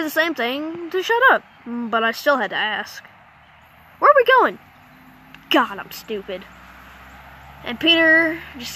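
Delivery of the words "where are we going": blurted question. Retold or imitated. Kidnapped, asks captors anyway.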